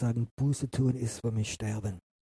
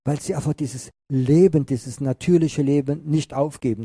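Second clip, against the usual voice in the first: louder, higher fervent sermon push; preaching at full force; 4 semitones higher, 5 dB louder than usual. Acted. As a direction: drive the point home to the congregation.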